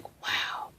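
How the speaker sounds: breathless whispered wow; awed whisper. Retold or imitated. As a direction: whisper it in awe at the mirror. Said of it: "I was like".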